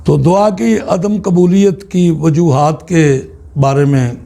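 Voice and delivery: usual speaking voice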